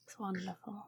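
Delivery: whispered, touched; hushed thanks between friends; soft whisper. Acted. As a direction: breathe it out softly.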